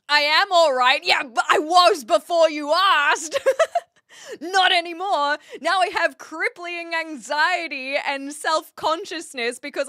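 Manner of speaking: loud, laughing protest; laughing exasperation. Real, not acted. To British chat, laughing loud exasperation. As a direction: laugh while insisting you were fine.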